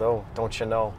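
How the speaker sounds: lightly voiced Minnesota "don't you know", low and soft; a hint of Minnesota, tossed off softly; Minnesota accent. Host jokes in a mock Minnesota accent. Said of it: "I do a Minnesota accent".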